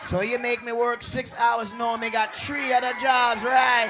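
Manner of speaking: indignant Jamaican tirade, building to a shout; Jamaican accent. Acted. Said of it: "Don't get a Jamaican".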